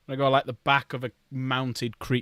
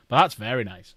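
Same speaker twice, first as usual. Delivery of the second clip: usual speaking voice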